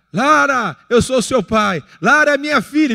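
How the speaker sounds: loud teasing yell across the mall; playful shouting. Retold or imitated. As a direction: shout it out loud to embarrass his daughter. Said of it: "E aí eu comecei a gritar". (pai gritando de brincadeira no shopping, bem alto)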